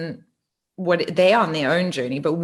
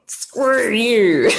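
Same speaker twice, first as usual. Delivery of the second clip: louder, higher mock scorn; mock defiant; 5 semitones higher, 6 dB louder than usual. Thinking about the ex who never replied, in mock scorn. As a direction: snap it out like a playful curse. Her thought when ignored.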